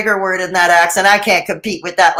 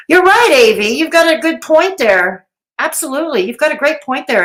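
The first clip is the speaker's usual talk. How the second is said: sarcastic, pitched up, overdone agreeing; mock agreement; 6 semitones higher than usual. Teasing a chat viewer with sarcastic agreement. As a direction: pretend to agree while mocking.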